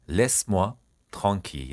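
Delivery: "Laisse-moi", pause, "tranquille"; word by word. Shown as a demonstration. Teacher modelling a French phrase for learners, piece by piece. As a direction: split the phrase into two measured chunks.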